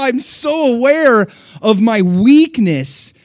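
high, loud, slowing preaching; impassioned sermon testimony; full-force preaching. Acted. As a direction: pour out the struggle to the congregation. Preaching climax rebuilds.

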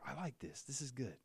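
soft, understated approval; mild enjoyment. Acted. Preacher acting out an ordinary enjoyable Bible reading, mildly pleased. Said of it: "it's enjoyable".

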